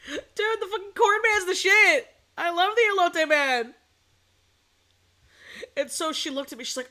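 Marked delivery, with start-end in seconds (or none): 0.1-3.7 high, loud, acted-out enthusiasm; excited raving. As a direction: gush over it to a clueless friend. Retold raving to neighbor.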